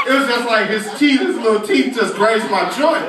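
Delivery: ordinary talk, nothing marked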